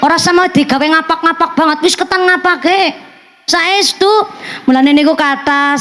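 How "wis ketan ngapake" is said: normal talking voice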